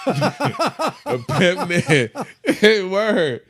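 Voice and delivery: loud, high words through real laughter; cracking up. Real, not acted. Guest admitting he played a pimp, cracking up. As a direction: laugh helplessly while getting it out. Cracks up revealing his role.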